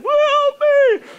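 high, loud comic scream for help; panicked cry. Retold or imitated. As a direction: yelp helplessly in mock panic. Blind rider crying for help.

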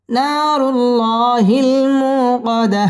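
slow, sung tajweed chant; Quran recitation. Shown as a demonstration. Teacher reciting the surah for students to follow, chanted. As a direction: let each line ring out melodically.

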